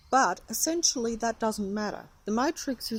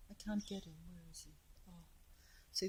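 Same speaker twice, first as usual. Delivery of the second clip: normal talking voice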